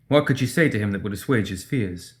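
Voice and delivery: ordinary delivery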